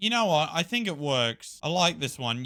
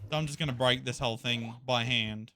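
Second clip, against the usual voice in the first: usual speaking voice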